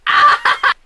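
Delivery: sudden loud, high scream; high yell of exasperation; frustrated scream. Real, not acted. Frustrated scream at own mistake.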